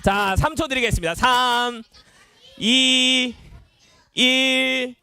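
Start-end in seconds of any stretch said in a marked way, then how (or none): 1.2-5.0 long, held counts with pauses between; drawn-out countdown. Real, not acted. Host gives the children three seconds, drawn-out countdown. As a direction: count down slowly and evenly, holding each number.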